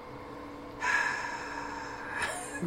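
long, drawn-out frustrated sigh; exasperated sigh. Retold or imitated. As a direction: blow out all your frustration. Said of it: "All these exasperated sighs".

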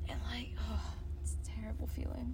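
hushed, breathy lament; whispering. Real, not acted. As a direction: whisper it like a sad confession. Lament about long bike ride.

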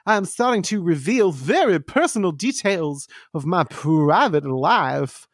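light breathless Southern belle; a hint of a gushing debutante. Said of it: "I have also taken on the voice of a Southern debutante".

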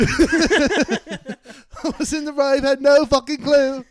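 high, loud, slow laughing British voice; mock British accent. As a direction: laugh through a hammed-up British raver. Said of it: "Talk like this".

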